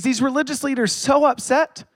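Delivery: usual speaking voice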